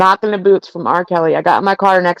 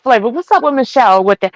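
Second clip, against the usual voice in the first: ordinary talk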